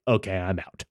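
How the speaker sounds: low, soft fed-up sigh of a line; lightly voiced weary give-up; done with it. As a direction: mutter it like you're walking out. Quoting own reaction, giving up.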